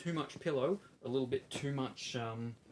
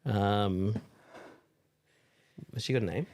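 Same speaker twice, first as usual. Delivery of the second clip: triumphant shout; loud; 10 dB louder than usual. Real, not acted. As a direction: belt it out in triumph. Toy finally stuffed, triumphant.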